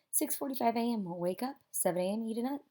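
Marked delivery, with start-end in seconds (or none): none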